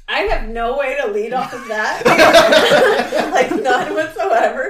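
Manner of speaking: loud laughter breaking through words; helpless giggling while talking; laughing. Real, not acted. Cracks up at the joke.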